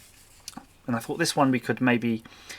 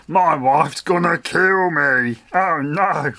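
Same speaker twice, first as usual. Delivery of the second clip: louder, slow, panicked soap hard man; Greg Mitchell; 5 semitones higher, 7 dB louder, much slower than usual. Retold or imitated. Crafter voicing the soap hard man fearing his wife, louder and slow. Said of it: "Greg Mitchell".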